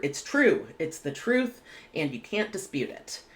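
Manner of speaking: ordinary delivery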